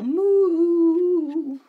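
loud drawn-out moo; booming cow moo. Acted.

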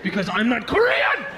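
hammed-up indignant correction. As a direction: burst out, insulted at the assumption. Indignant answer of mistaken man.

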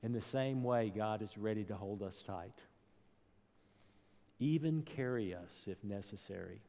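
ordinary delivery

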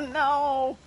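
ordinary talk, nothing marked

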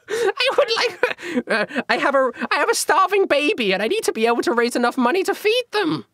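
put-on high, laughing plea about a starving baby; squeaky peddler begging for a sale; pleading merchant. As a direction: beg pitifully in a squeaky voice. GM voices seller pleading.

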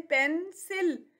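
Pencil slowly in two beats; split into syllables. Shown as a demonstration. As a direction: break pencil into पेन and सिल. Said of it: "इसमें टू syllables हैं". (Pencil को दो हिस्सों में, धीरे)

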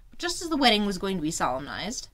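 plain reading voice

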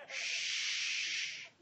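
soft whispered shush; whispered shh. Acted. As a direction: hush everyone.

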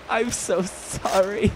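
soft, high, laughing apology; giggly apology. Real, not acted. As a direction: apologise while fighting back laughter.

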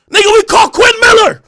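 high, loud hollered announcement; mock triumphant boast. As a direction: imitate a crew member shouting proud news. Acting caller's triumphant boast.

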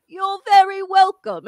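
high, loud, slow impression of a rock star; stretched-out, hammed-up British courtesy. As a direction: call it out slowly in a bad British accent. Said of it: "my best [name] impression".

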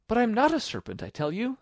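put-on high, insistent girl's voice; exasperated high-pitched denial; protesting Alice. A narrator's reading. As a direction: insist in a high little-girl voice.

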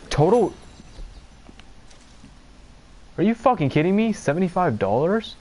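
can't believe the bill, shouting it out; outraged disbelief. Real, not acted. Gamer reacting to a seventy-five dollar total, high outraged disbelief.

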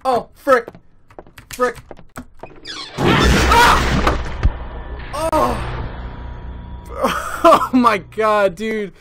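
high, loud frightened yells; scared screaming. Real, not acted. Jump scare, camera falls.

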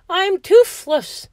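high, slow, loud lament; moaning. A narrator's reading. George realises he has no teeth left, moaning. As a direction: wail it in hammed-up despair. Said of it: "George moaned".

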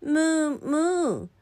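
put-on high, light childlike moo; child mimicking cow. Child's cow sound before question.

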